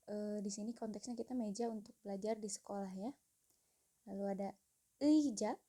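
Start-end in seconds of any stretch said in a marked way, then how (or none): 5.0-5.5 eija said slowly and clearly, a bit higher; Korean pronunciation. Shown as a demonstration. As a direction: model eija for the class to repeat. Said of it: "Jadi ini cara pengucapannya eija". (pelafalan Korea eija, jelas dan pelan)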